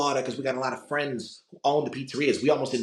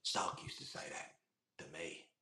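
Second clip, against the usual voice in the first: Ulysses Klaue; 7 semitones lower, 16 dB quieter than usual. Acted. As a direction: trail down into a low whisper. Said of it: "Ulysses Klaue from Avengers two".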